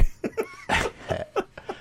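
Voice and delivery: squealing high laugh; high cackling laugh. Real, not acted. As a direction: burst into a shrieking laugh. Big laugh.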